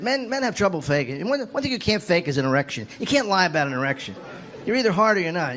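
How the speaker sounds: ordinary talk, nothing marked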